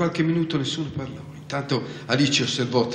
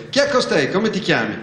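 higher, sharp questioning of a queen; stern shout; 6 semitones higher, 6 dB louder than usual. A narrator's reading. Queen questions the unknown girl, stern shout. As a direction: demand it with a stern frown. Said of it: "gridò con cipiglio severo".

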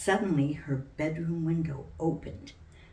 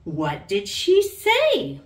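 slow, higher and louder, eager to know; curious question; 6 semitones higher, 7 dB louder, slower than usual. A narrator's reading. Mommy asks Sally about the call, slow and curious. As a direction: lean in with curiosity. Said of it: "Mommy asked curiously".

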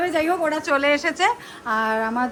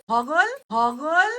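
mock drawl; 4 semitones higher, much slower than usual. Mocking a nickname, drawn out.

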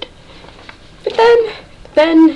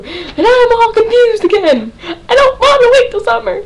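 high, mock-tearful whine; hammed-up crying fangirl protest; whiny wail; 7 semitones higher than usual. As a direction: wail it high like a pouting fan. Whining about waiting for next season.

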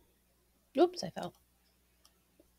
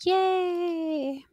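excited cheer; 10 semitones higher, 3 dB quieter than usual. Real, not acted. Second cheer, high and far.

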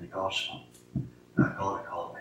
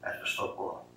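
hushed confession; 13 dB quieter than usual. Real, not acted. Confessing the thing God didn't want.

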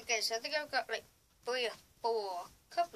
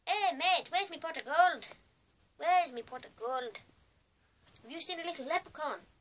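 higher, louder mock Irish leprechaun hunter; put-on Irish lilt, louder; Irish accent; 6 semitones higher, 6 dB louder than usual. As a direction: ham up an Irish accent, pitched up. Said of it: "that doesn't sound anything like Irish".

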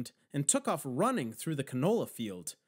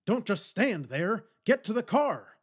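loud, raised-pitch gruff command; growled order; 5 semitones higher, 5 dB louder than usual. A narrator's reading. Old man ordering two companions to the car, growling. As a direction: growl it at them urgently. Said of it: "Garland growled at us".

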